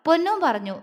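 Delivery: ordinary delivery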